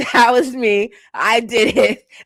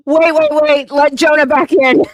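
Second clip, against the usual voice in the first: higher, louder urgent pleading; frantic call to the mods; panicked laughing plea; 6 semitones higher, 8 dB louder than usual. Real, not acted. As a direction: beg them quickly to undo it.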